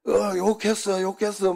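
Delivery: high whiny tattling voice; mocking a tattler. Mocking a fellow pastor who claimed he swore, whiny tattle. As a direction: mimic the other guy crying foul. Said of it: "한 놈이".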